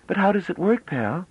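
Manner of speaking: put-on bright, eager girl's voice; young woman voice. A narrator's reading. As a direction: voice her as an excited young woman.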